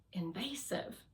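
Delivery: hushed, near-whispered word; hesitant admission. Acted. Resilience is reluctant to enter Courage's system, hushed and hesitant. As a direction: let it out reluctantly, very softly.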